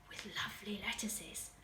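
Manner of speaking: put-on whispered aside to the listening children; hushed whisper. A narrator's reading. Reader whispers to the children about the lettuces. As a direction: breathe the line out in a whisper.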